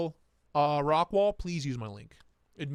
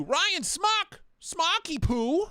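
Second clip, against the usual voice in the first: much higher, louder playful name call; excited greeting; 12 semitones higher, 3 dB louder, much slower than usual. Real, not acted. Excited greeting to chat friend.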